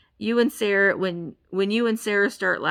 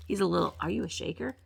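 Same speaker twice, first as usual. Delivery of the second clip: ordinary talk, nothing marked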